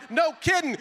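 high, loud, fed-up snap; exasperated yell. Retold or imitated. Dad yelling at daughter behind bathroom door, exasperated. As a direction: yell it like you're fighting off a dog.

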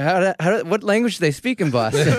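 can't hold back the laugh while quoting him; laughing through the quote. Retold or imitated. Patient's funny question, retold laughing.

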